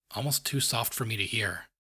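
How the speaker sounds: ordinary delivery, nothing marked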